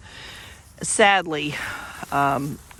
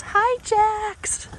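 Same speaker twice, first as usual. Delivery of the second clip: pet talk voice; 3 dB louder than usual. Real, not acted. Greeting horse in high voice.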